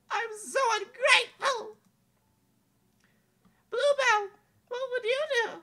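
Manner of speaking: put-on high, bright young princess, wistful; a lovely princess doubting fairy tales; princess Amelia voice.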